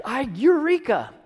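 high, slow exclamation like a father's sudden discovery; mock eureka cry. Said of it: "the dad's like".

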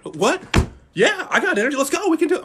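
put-on high, bright burst of kid energy with a laugh; suddenly eager kid. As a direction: snap from tired to thrilled. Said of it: "kid perks up for soccer".

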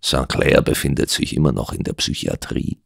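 hushed, near-voiceless aside; whispering. Acted. Doctor warns the detective aside, whispering. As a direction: whisper it softly to a friend. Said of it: "flüsterte ich meinem Freund zu".

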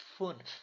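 "ফুনফ" with a rounded ü; umlaut vowel. Shown as a demonstration. Teacher models the umlaut for students, carefully. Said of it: "লম্বা করে পড়তে হয়".

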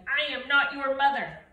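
loud, firm denial; stern. Acted. Grumpy bear scolding the goslings, loud and stern. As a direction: set them straight, loud and stern. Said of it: "Bruce was very stern".

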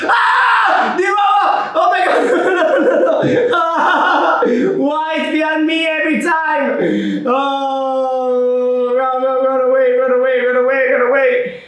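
high panicked shouting; being chased. Real, not acted. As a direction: scream and flee, half laughing. Chased again in game.

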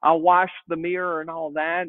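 ordinary talk

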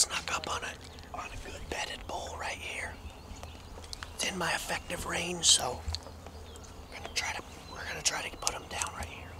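hushed hunter's whisper. Real, not acted. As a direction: whisper so the elk won't hear. Hunting video stalking narration whisper.

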